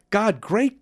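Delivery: high, loud burst of glee; a boy bursting in with big news; excited cry. Acted. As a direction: cry it out, thrilled. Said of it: "cried Carl".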